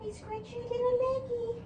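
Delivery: high sing-song with stress on little. Acted. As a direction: lift it up into a cooing sorry. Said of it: "repeated higher".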